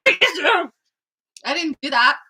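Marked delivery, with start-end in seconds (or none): 0.1-0.7 higher, hammed-up imitation of herself; mock romantic line. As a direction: ham up a pushy kiss request. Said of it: "just was like".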